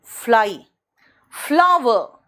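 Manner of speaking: "फ्लाई" and "फ्लावर" with a streaming f; English f. Shown as a demonstration. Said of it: "English में फा होता है".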